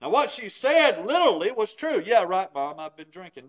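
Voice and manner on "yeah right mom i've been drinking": drip with sarcasm so it sounds like a denial; mock admission that means no; sarcastic. Shown as a demonstration. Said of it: "said it in such a way with voice inflection".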